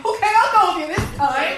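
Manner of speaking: loud goofy cartoon agreement; hammed-up cartoon pushover. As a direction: go along like a dopey cartoon dog.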